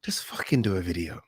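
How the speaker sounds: quiet whispered nag to himself; whispered self-talk. Retold or imitated. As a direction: hiss it under your breath like an inner voice. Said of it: "I woke up and I was like".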